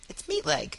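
Meatleg said as "meet-leg"; name pronunciation. Shown as a demonstration. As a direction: say Meatleg as two English words, meat and leg. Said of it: "ask him how to pronounce his last name".

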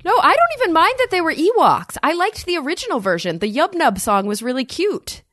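rushing to defend the Ewoks; excited. Real, not acted. Guest defending the Ewok song to the hosts, excitedly. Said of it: "I'm getting very excited".

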